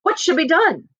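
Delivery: high, urgent plea; panicked husband. Acted. Husband asking what to do, high and panicked. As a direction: beg for help.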